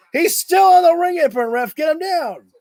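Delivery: frustrated fan shouting at the referee; yelling at the ref. Real, not acted. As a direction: tell the ref off through the screen.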